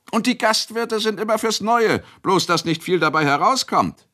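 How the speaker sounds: sehr hoch und laut, stichelnd; spöttisch. Acted. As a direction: Bauer zieht den Wirt auf. Spöttische Erwiderung eines Bauern. (Bauer spottet über den Gastwirt)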